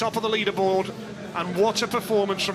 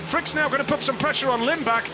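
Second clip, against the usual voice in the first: fast, high, loud race calling; excited race commentary; 6 semitones higher, 4 dB louder than usual. Real, not acted. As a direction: call the race as it gets tight. Live race play-by-play rising.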